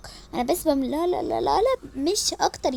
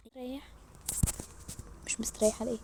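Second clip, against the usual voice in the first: whisper; 13 dB quieter, much faster than usual. Real, not acted. Hushed question during family chat.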